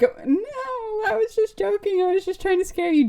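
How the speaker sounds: high-pitched, whiny backpedaling; hammed-up "only kidding" plea; mock innocent protest. Backpedaling after scaring a friend.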